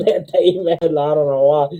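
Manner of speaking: normal talking voice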